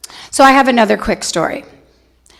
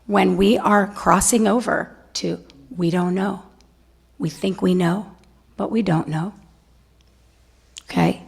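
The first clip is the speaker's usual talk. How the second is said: normal talking voice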